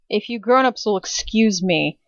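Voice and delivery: ordinary talk, nothing marked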